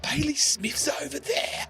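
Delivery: mock high squeal of a teen girl; excited girl. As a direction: imitate a checkout girl spotting a star. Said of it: "she goes".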